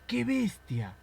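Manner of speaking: high, loud frightened yell; frightened shout. Shown as a demonstration. Moviegoer screams at the monster, high and loud.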